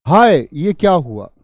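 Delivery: loud, slow anguished cry; grief. Shown as a demonstration. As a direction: wail it out in grief. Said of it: "दुख का भाव है". (ज़ोर से धीमी दुख भरी पुकार)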